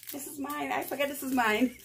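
high, quick, squealing amusement; amused realisation. Real, not acted. Vlogger admitting she forgot it's hers, amused. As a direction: squeak it out, tickled at yourself. Amused at mistaking own item.

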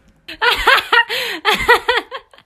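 high-pitched cackling burst; loud laugh. Real, not acted. Joking with companion over the kiwi receipt, loud high laughter.

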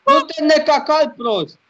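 high, loud yelling at a drowning horse; frantic shout. Real, not acted. Gamer shouting at his drowning horse, frantic. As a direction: scream at the horse not to drown.

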